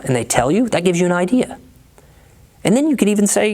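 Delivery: ordinary talk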